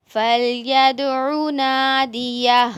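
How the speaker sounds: slow, loud tajweed reading; loud recitation. Shown as a demonstration. Teacher reciting the word loudly and slowly for the children. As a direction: call each syllable out slowly for the class.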